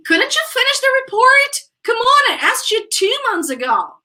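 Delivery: high-pitched, quick reproachful question; high, incredulous complaint; exasperated intonation. Shown as a demonstration. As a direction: demand it, pestering someone who is overdue. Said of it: "Look at the intonation".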